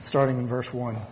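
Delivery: ordinary talk, nothing marked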